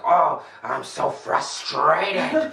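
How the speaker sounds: high, very slow mock whine; mock frustrated moan to yourself. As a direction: drag out the frustration.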